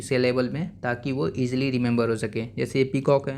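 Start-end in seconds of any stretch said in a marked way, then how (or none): none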